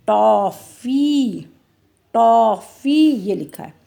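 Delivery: usual speaking voice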